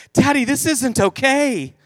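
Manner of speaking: put-on high, slow child's scolding; mock-stern little girl; disapproving little daughter. Young daughter disapproves, acted out.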